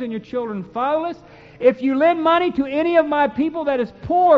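loud, solemn preaching of the law; fervent scripture reading. Acted. Preacher reading Exodus to his congregation, loud and fervent. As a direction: proclaim each verse like a warning.

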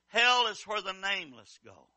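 ordinary talk, nothing marked

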